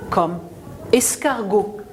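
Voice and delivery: ordinary talk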